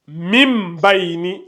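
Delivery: "mim bayni" slow, m drawn out before b; qalb pronunciation. Shown as a demonstration. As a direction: hold the m of "mim", then "bayni". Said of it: "Ça donne". (« mim bayni » lentement, m prolongé)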